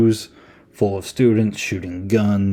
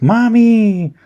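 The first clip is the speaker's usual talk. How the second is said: put-on high shrill-free wail of a little boy, much higher and louder; childlike wail; 14 semitones higher, 8 dB louder than usual. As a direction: cry out like a scared little boy calling his mom. Jason's face melts, he cries.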